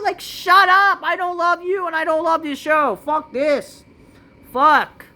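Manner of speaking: high, loud annoyed outburst; fed-up rant. Real, not acted. Vlogger venting at the show, loud and high. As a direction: snap at it, fed up.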